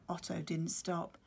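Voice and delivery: plain reading voice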